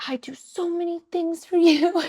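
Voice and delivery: high whiny toddler imitation. Mother mimicking her son's complaint in a high toddler voice. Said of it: "He was like".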